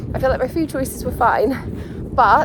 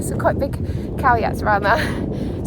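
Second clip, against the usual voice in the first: ordinary talk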